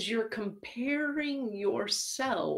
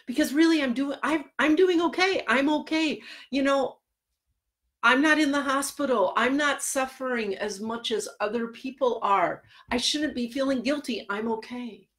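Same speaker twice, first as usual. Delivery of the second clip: higher and louder, insisting they're fine; anxious client; 6 semitones higher, 4 dB louder than usual. Retold or imitated. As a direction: talk yourself out of feeling guilty. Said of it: "they'll say to me".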